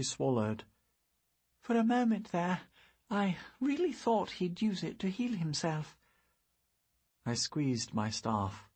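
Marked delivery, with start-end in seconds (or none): 1.6-6.0 put-on higher, puzzled girl's voice; young girl. Girl musing to her friend about the broken orb, high puzzled voice. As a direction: wonder aloud like a young girl.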